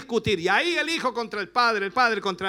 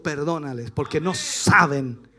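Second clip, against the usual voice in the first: gentle forgiveness; 9 semitones lower than usual. Retold or imitated. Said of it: "Jesús dijo".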